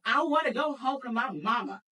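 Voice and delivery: put-on loud, high childish wail for mama; mock wail. Scared teen begging her sister, in a loud childish wail. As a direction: whine it like a scared kid.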